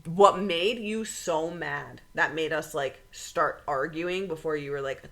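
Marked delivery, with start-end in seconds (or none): none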